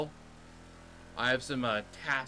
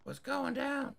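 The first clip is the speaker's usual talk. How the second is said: high-pitched tough guy voice; weirdo tough guy; slower than usual. Acted. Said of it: "weirdo tough guy".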